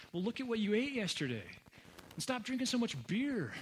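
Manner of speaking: high-pitched, incredulous scolding; exasperated God. Acted. God to someone who wakes feeling terrible, exasperated. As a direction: point out the obvious, voice swooping up.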